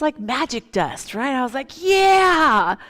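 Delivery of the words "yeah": a loud, high, jubilant shout; triumphant yeah. Retold or imitated. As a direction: shout it like you just won. Triumph at magic result.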